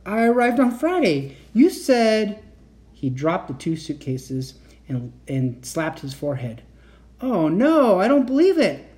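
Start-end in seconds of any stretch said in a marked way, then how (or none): 0.1-2.4 high, flustered protest; stammered protest. A narrator's reading. Kat telling uncle when she arrived, flustered stammer. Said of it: "I stammered". 7.2-8.8 high, loud exclamation of dismay; dismayed realisation. A narrator's reading. Uncle realising he mixed up dates, dismayed.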